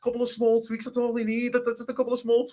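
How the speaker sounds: high, dismissive imitation of a manager; mock manager. Pundit mimicking a manager's interview, high mock voice. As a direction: brush it off like a smug boss. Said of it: "you said".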